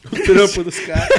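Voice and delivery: loud laugh through words. Real, not acted. Laughing at the joke.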